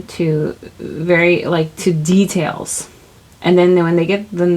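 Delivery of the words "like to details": normal talking voice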